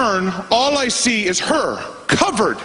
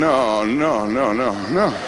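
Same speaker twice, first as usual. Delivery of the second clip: low, sinking string of no's; hammed-up low dread; mock dread; 7 semitones lower, slower than usual. Dreading the tape being slapped on.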